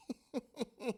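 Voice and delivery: high put-on giggle; mock cop cackle. Cop laughing at his own baton hit, high giggle. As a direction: cackle like a cocky young cop.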